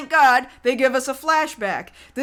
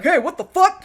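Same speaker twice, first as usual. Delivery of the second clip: startled, raised-pitch mock outburst; freaked out; 5 semitones higher, 3 dB louder than usual. Podcaster imitating a guard at the banging door, freaked out. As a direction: act out a guard's baffled alarm. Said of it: "he's a little bit freaked out".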